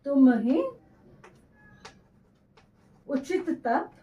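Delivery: slow verse read in phrases with long pauses; dictation reading. Acted. Teacher dictating the verse to students, slow and phrase by phrase. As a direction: read each phrase slowly so students can write it down.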